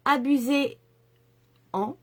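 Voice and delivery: abusez-en stretched out slowly, each syllable placed; slow careful abusez-en. Shown as a demonstration. Urging viewers to use the yellow, slowly and carefully articulated. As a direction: spell out abusez-en slowly and deliberately. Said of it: "je vais y arriver".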